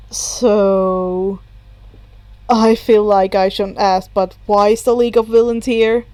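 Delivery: put-on a tired boy who'd rather not ask; deadpan Hitoshi. A narrator's reading. Hitoshi voiced lower.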